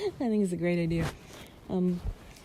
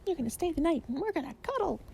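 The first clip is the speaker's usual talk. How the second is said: higher, lightly voiced Elaine from Seinfeld; a light hint of an Elaine line; Elaine impression; 9 semitones higher, faster than usual. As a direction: quote Elaine playfully, pitched up. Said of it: "It's like Elaine on Seinfeld".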